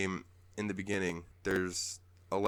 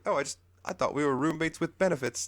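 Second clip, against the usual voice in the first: high, quick protesting voice; hammed-up bashful character, pitched up; flustered Snorpy; 9 semitones higher, 4 dB louder, faster than usual. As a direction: blurt out a flustered excuse. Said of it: "Snorpy's like".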